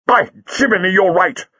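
high-pitched, jubilant exclamation; exultant. Acted. Jago sees Lightfoot's escape plan, exultant. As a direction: burst out in delighted agreement. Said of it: "said Jago exultantly".

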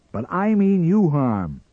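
loud, high-pitched threatening retort; angry threat. Acted. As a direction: throw the threat back forcefully. Sam threatens the Martian.

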